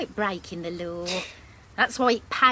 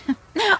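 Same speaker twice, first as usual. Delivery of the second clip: light laugh; 10 semitones higher, 5 dB louder than usual. Acted. Mother laughs at own joke.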